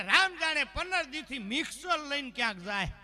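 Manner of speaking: high, loud comic retort; exasperated neighbour. Retold or imitated. Neighbour reports husband wandering with mixer, loud exasperation.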